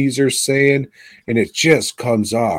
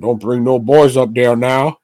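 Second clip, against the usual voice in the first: lightly voiced folksy dad; a hint of a warning father, slightly raised; 3 semitones higher, 3 dB louder than usual. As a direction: put on a mild dad giving orders. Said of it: "And her dad said".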